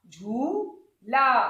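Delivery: झूला broken into slow झू, ला; very slow झूला with a long ऊ. Shown as a demonstration. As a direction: stretch झू, pause, then ला. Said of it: "तो लंबा है".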